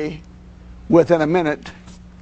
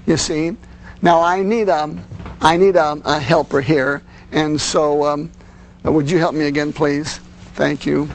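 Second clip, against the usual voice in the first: normal talking voice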